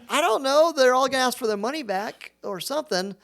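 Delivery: anxious. Retold or imitated. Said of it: "I said".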